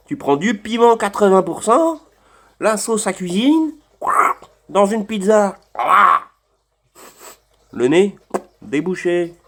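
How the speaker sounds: excited. Real, not acted. Excited rant about spicy sauce.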